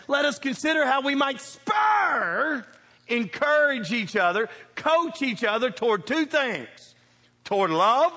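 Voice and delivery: slow emphatic sermon shout; preaching scripture. Acted. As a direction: proclaim it to the congregation. Quoting scripture loudly.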